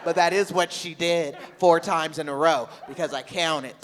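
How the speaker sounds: ordinary delivery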